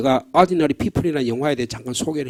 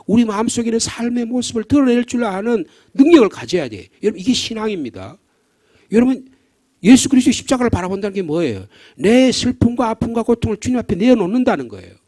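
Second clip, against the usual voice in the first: impassioned preaching; 6 semitones higher, 4 dB louder than usual. Acted. Preaching at full force.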